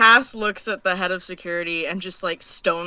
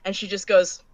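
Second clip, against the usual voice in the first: ordinary talk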